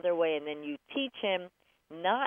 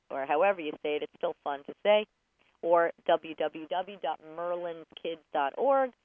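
ordinary talk

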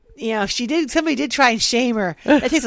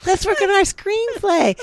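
high cheery invitation; 11 semitones higher, much slower than usual. Retold or imitated. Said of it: "I said".

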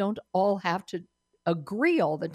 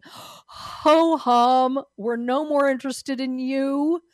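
drawn-out, louder "ho hum"; mock boredom; 4 semitones higher, 4 dB louder, slower than usual. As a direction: play up the indifference of an unimpressed public. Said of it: "a lot of people in America are going".

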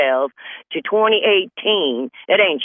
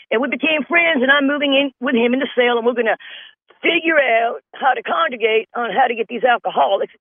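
high, hammed-up Barney voice; Barney Fife impression; 7 semitones higher than usual. Deputy gushing to Andy about his new cellmate, high and giddy. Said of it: "He would bring him into town".

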